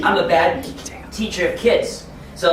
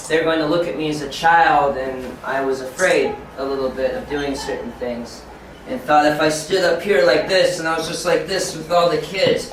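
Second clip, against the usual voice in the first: ordinary talk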